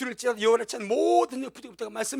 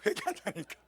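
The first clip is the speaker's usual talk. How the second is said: normal talking voice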